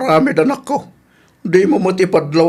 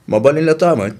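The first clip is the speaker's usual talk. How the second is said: ordinary talk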